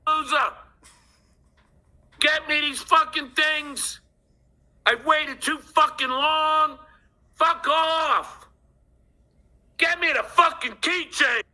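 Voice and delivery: hammed-up rage, screamed high and slow; furious yelling. Caller reenacting screaming at the keychain seller, enraged shouting. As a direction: scream it down the phone, fed up. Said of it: "And I was fucking pissed".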